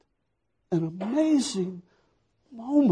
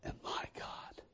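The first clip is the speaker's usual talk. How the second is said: whisper; 20 dB quieter than usual. Acted.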